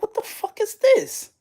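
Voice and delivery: sudden alarm at what you see. Retold or imitated. Alarmed question in dream.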